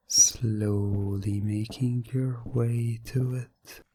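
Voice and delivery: normal talking voice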